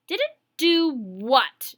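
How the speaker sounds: slow, loud, drawn-out demand; stern demand. Acted. Teacher stopping the boy in his tracks, slow stern demand. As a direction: lean on every word, daring him to answer. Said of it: "she demanded".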